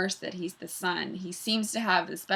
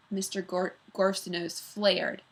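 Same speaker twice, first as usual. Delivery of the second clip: plain reading voice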